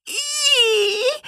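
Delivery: very high disgusted squeal; girl's shriek. Acted. Mona with egg on her head, high shriek. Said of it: "kreischte sie auf".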